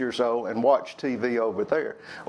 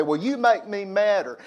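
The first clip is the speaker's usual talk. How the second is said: sister's retort; 6 semitones higher than usual. Retold or imitated.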